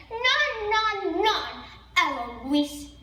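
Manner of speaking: usual speaking voice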